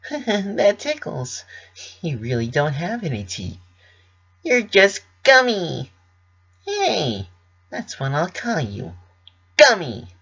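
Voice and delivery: put-on tickled and charmed by the toothless baby; delighted Pinkie voice. Pinkie tickled, names her pet.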